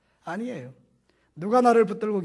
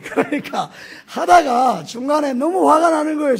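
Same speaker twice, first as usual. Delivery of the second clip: laughing exasperation; 9 semitones higher, 11 dB louder than usual. Real, not acted. Recounts rising anger at bathhouse.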